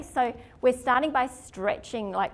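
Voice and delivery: ordinary talk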